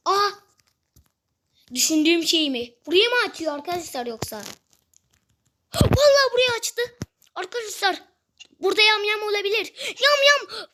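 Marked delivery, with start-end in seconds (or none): 5.7-10.7 high, excited alarm; startled, high-pitched discovery; startled excitement. Real, not acted. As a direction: blurt it out excitedly, pitch jumping up. Door opens, spots a cannibal.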